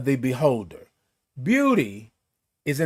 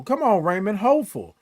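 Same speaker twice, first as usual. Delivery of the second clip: ordinary delivery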